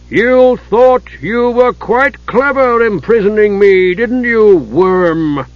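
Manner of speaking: high, loud, slow sneering giant; menacing giant. A narrator's reading. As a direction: sneer down at the cowering dwarf.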